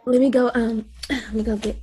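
ordinary delivery

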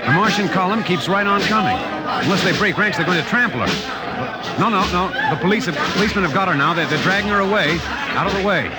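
urgent, rising announcer commentary at a fast clip; excited live report. Acted. Radio announcer to listeners, urgently describing the fainting woman. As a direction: report the chaos breathlessly as it unfolds.

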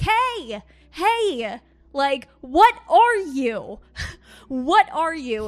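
loud, drawn-out call to an unseen spirit. Retold or imitated. To the lion spirit in the house, loud slow calling.

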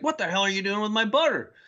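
high-pitched mock outrage; indignant outburst. Dad catching his child with his butter, indignant. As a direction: imitate an affronted dad protesting.

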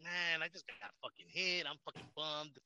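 quiet, slow, deflated grumble; slow, quiet, mock-glum crash victim; mock bummed driver. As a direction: act sorry for yourself after a crash. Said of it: "but it's like".

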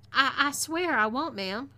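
a nervous, meek stammer. A narrator's reading. Tied-up captive promises the captor to behave, meekly. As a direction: promise meekly, tripping on the first word. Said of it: "I replied meekly".

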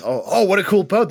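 put-on wide-eyed kid seeing a new toy; excited Luffy. Luffy admiring the boat.

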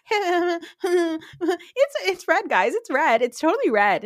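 whiny, put-on imitation of a castmate; mocking Kyle. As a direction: mimic an annoying friend insisting. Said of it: "She's so annoying. She's like".

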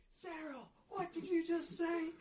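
very high, drawn-out boss imitation; mock scolding boss. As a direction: imitate the boss catching someone out, high and slow. Acts out boss catching coworker.

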